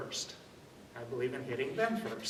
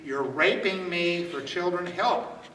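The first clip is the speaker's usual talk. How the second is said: loud demo yell for help; 6 dB louder than usual. Shown as a demonstration. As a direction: call out so witnesses turn around. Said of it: "things that you yell to create witnesses".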